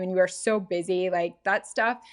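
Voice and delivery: normal talking voice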